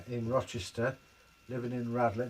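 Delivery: usual speaking voice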